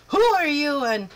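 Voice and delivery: booming loud slow bellow; blasting. Acted. North wind flinging open the door, a slow booming blast. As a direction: blast it out like a gale. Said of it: "and blasted".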